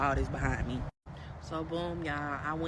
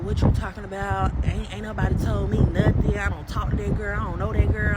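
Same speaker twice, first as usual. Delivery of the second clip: loud mock denial; 3 semitones higher, 9 dB louder than usual. Retelling the boyfriend denying he knows that girl, loud and defensive. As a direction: imitate his defensive denial, voice raised. Retold man's denial in phone quarrel.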